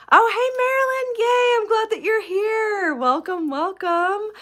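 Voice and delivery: high, loud, excited welcome; delighted greeting. Real, not acted. Host spots a viewer joining the live stream, delighted greeting.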